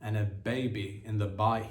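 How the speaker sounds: ordinary delivery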